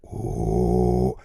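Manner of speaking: very low loud distorted roar; ultra low rumble. Shown as a demonstration. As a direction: grind it out very low. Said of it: "we can go from".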